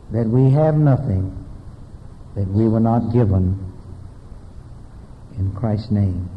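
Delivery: low, calm closing prayer. Acted.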